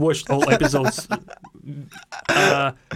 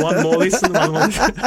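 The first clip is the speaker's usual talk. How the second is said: laughing mock censor; 3 semitones higher, 5 dB louder than usual. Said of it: "And they're like".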